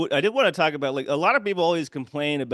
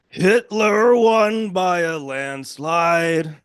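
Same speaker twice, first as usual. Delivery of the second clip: very slow, louder, higher put-on singer's voice; 3 semitones higher, 5 dB louder, much slower than usual. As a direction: drag each word out like a boozy old balladeer. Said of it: "sounds just like this".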